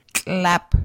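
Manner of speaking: "clap" with a tl clicked out the side of the mouth. Shown as a demonstration. Teacher modelling the tl sound for learners, side click. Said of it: "a click T L out the side of your mouth".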